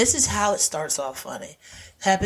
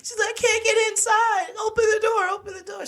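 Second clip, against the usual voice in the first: high crying mock plea; mock panicked wail. Sandra Oh begging Annie to open the door, high mock wail. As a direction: wail to be let in.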